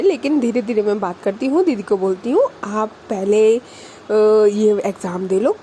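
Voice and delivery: calm her worries tenderly; soothing reassurance. Retold or imitated. [name] reassuring anxious girlfriend.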